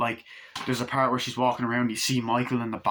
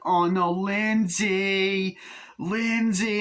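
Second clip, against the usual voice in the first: higher, slow calls for help; stuck teen calling the kid, high and drawn out; high distressed call; 8 semitones higher, much slower than usual. Retold or imitated. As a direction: wail her name stuck and helpless. Annie stuck in window.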